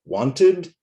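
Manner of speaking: "Wanted" in two clear syllables, high and loud. Shown as a demonstration. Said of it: "two syllables".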